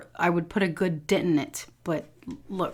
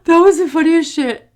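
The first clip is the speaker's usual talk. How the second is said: ordinary delivery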